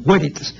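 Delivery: "Veritas" as WÉ-ri-tas, opening on a W glide; classical Latin veritas. Shown as a demonstration. Teacher repeating the classical reading for the listener. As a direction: say "Veritas" with a W, like the u of hueso. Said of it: "pronunciación clásica".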